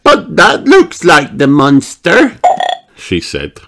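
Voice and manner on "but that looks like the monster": high, slow, loud little-pig voice; excited Peppa. A narrator's reading. Peppa points to the other end of the log, excited. Peppa spots something on the loch.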